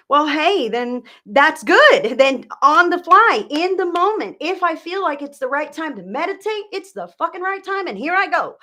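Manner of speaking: loud, high, bursting with go-for-it energy; like someone who just decided on the spot; excited. Pretend. Psychic cheering a client to act on impulse, loud and high. Mimicking the client being spontaneous.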